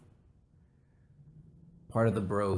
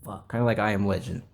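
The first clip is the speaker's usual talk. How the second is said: ordinary talk, nothing marked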